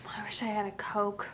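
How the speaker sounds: voiceless, hushed whisper. Acted. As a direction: whisper the line to yourself. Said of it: "Let's go for the next mutation".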